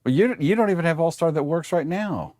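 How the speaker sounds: normal talking voice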